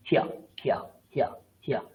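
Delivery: হিয়া clipped fast, hi then ya, four times; quick pronunciation drill. Shown as a demonstration. Teacher showing students how to say হিয়া. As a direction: rattle off হিয়া as hi-ya. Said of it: "এরকম উচ্চারণ করুন".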